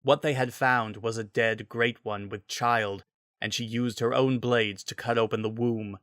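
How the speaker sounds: usual speaking voice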